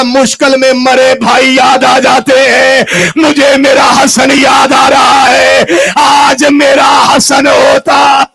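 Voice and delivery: loud, slow, anguished shout; a brother screaming out his grief, loud and slow; crying out in grief. Acted. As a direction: wail the lost brother's name. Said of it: "Hussain चीख मार के कहता".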